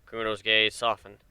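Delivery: plain reading voice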